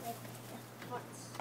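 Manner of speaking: whispered repeat. Real, not acted. Kid repeats himself under breath.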